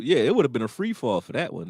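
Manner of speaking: usual speaking voice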